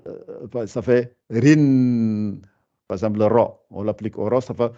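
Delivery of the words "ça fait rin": very slow rin, the tanwin n held; drawn-out rin. Shown as a demonstration. Teacher showing the tanwin sound, drawn out. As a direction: stretch rin out slowly. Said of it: "Quand c'est deux traits en bas".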